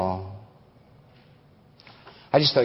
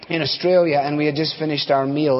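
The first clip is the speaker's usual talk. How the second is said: ordinary delivery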